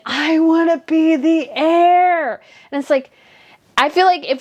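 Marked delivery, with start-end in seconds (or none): 0.1-2.4 high, slow, drawn-out mock whine; whiny mock scream. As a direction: wail it like a spoiled prince crying to the wind. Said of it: "screaming to the wind".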